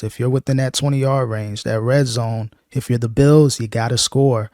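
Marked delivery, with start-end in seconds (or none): none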